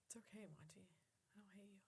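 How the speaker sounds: whispered, gentle reassurance to the dog; calm the dog down softly; soothing whisper. Real, not acted. Comforting the crying dog.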